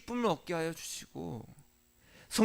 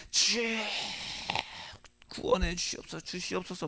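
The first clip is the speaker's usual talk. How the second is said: mock fervent prayer; 6 dB quieter than usual. Preacher shows how churchgoers pray, mock fervent. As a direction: lightly mimic a believer crying out in prayer. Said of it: "열정적으로 기도를 합니다".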